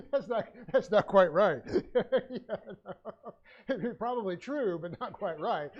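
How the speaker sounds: real amused laughing; laughing through words. Real, not acted. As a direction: get the words out between laughs. Laughs at own anecdote.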